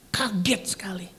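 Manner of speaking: bisik, terkejut ngeri; bisikan kaget. Acted. Terkejut dibawa ke neraka. (bisikan terkejut)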